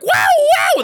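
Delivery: high shouted whoops. Retold or imitated. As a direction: yell out wildly. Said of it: "they're like".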